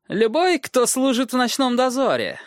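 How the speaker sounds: high, mocking echo of the lord; mocking dwarf voice. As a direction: throw his words back with scorn. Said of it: "отвечал карлик". (насмешливый голос карлика)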